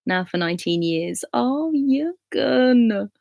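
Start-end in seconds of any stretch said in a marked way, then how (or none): 1.3-3.1 long drawn-out, high-pitched aww; very slow, cooing and moved; touched, adoring. Real, not acted. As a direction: stretch it out, melting at a sweet story. Touched by viewer's love story.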